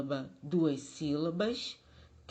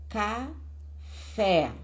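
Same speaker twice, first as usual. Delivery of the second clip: café split in two, FÉ louder; stress on final syllable; 3 semitones higher, 4 dB louder than usual. Shown as a demonstration. Teacher showing the tonic syllable, café with strong FÉ. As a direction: punch the second syllable of café. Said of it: "A sílaba tônica é fé".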